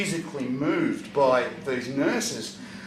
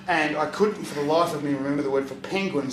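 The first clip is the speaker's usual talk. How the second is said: ordinary talk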